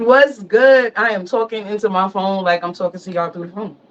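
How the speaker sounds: ordinary delivery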